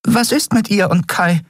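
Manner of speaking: high, small worried question; a little fairy fretting about her friends; anxious fairy. A narrator's reading. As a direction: ask it in a tiny high voice.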